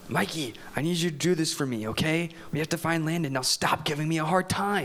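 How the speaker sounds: low, hushed urging; soft, firm whisper. Acted. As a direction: press him quietly to cooperate. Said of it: "They whisper as they creep along".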